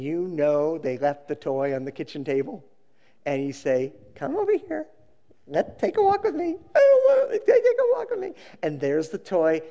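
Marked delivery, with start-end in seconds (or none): 4.2-8.3 put-on coax, then whine like the child; parent and crying child.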